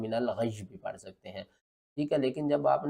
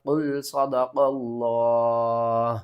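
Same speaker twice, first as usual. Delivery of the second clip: recited phrase; 5 dB louder, much slower than usual. Shown as a demonstration. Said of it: "وقف جبریل علیہ السلام".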